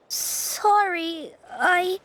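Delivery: breaking into tears, slowing down; tearful apology. Acted. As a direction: apologize as the crying takes over. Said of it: "gets distracted easily by crying".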